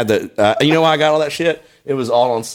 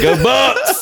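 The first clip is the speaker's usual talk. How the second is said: high, laughing fan cheer; mock cheer; 12 semitones higher than usual. Cheering for the Bucks while laughing at an old photo, a high laughing fan cheer.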